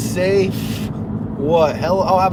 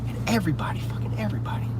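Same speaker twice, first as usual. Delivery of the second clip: whisper; 2 semitones higher, 10 dB quieter, faster than usual. Real, not acted. Hushed telling aside.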